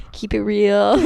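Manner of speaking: laughing repeat. Real, not acted. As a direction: say it again through a laugh.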